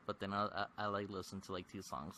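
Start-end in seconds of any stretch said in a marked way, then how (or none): none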